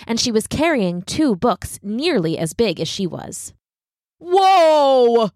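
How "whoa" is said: loud, high startled shout; a kid's sudden loud gasp of surprise; startled whoa. Acted. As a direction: jump back in shock. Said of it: "who was so surprised".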